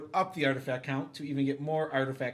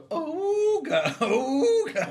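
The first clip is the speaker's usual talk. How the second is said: high squawky ooga ooga horn; ahooga horn; 11 semitones higher, 6 dB louder, much slower than usual. Pretend. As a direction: honk it like an old car horn. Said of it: "Should we just go".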